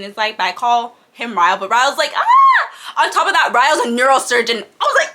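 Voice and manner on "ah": a high loud laughing squeal; giddy squeal. Retold or imitated. Retelling Ryle's line to viewers, a giddy squeal. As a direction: squeal with delight.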